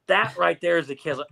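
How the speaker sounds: ordinary talk, nothing marked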